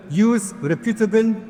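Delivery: usual speaking voice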